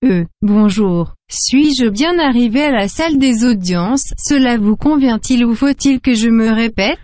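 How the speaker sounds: flat formal translation machine; machine translator voice. Acted. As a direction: stiff translator box voice.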